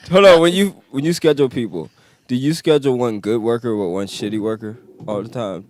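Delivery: loud, eager high question; excited. Real, not acted. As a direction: burst in excited.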